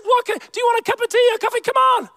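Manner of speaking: high, quick motherly offer; imitating an eager mum fussing over her child; high-pitched, hurried hospitality; doting mum. Acting out mum pressing food on him.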